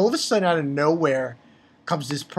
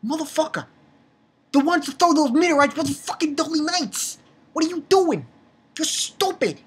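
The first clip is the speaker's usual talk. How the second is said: higher, fed-up pretend telling-off; exasperated rant; 9 semitones higher than usual. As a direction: chew him out in disbelief. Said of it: "I'm like".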